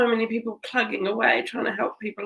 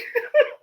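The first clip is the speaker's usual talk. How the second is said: teary laugh. Real, not acted. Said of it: "looking at myself crying".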